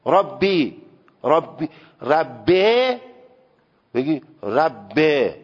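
ربی drawn slowly toward ربه, Persian-flavoured; slow Persian-accented ربه for ربی; heavy Persian accent. Shown as a demonstration. Teacher mimicking a thick Persian accent on ربی.

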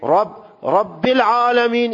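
Rabbil-'alamin slowly, with a clear kasra "i" after رب; kasra shown. Shown as a demonstration. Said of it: "این کسره است".

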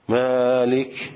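مالک with the first vowel held long. Shown as a demonstration. Teacher showing Arabic lengthening of مالک. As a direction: stretch the a of مالک slowly.

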